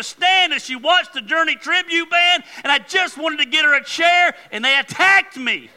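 loud, high-pitched comic self-pity rant; mock indignation. Preacher playing the wronged wasp victim to his congregation, mock-indignant. Comic protest over being one-upped.

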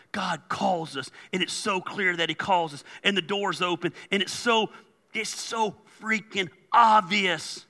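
normal talking voice

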